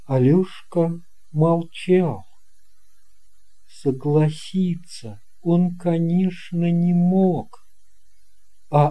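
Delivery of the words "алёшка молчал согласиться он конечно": ordinary delivery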